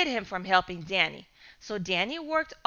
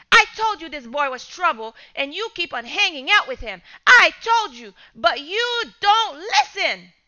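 louder, peaking high on I told you; scolding father; 5 semitones higher, 6 dB louder than usual. Acted. Father scolding son about his friend, exasperated anger. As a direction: lecture him with rising anger.

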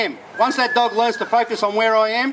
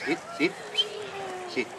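quiet soothing command; 8 semitones higher, 13 dB quieter than usual. Real, not acted. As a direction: hush her down gently to sit. Quietly settling pup to catch.

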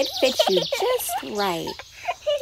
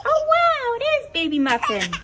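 excited; 10 semitones higher, 3 dB louder than usual. Pretend. Greeting the baby toy.

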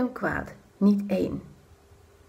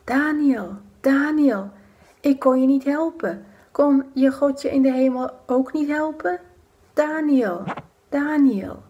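calling out; 5 semitones higher than usual. Acted. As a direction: call his name desperately into the dark. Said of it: "Hij roept". (roepend in de kuil)

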